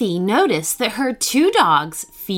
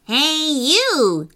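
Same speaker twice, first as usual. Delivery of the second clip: cross monkey character, pitched up; 5 semitones higher than usual. A narrator's reading. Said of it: "said the monkey crossly".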